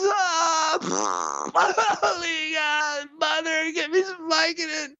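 very high, whiny crying plea to God; hammed-up agonized wail. Bleeding after surgery and begging God for help, a high crying wail. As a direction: wail and beg like a melodramatic sufferer. Retelling post-surgery agony, praying.

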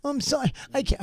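high, soft, fast mock sobbing plea. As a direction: ham up a tearful panicked girl.

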